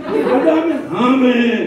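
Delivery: high call, then a long falling amen; put-on unwilling response; mock grudging amen. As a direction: imitate a congregation forcing out its amen. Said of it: "Tapi wajahnya dengan".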